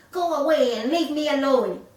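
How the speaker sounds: loud, high rude snap of a spoilt chick. A narrator's reading. Chick driving the animals away, rudely. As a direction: shove them off with the words. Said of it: "in a very rude voice".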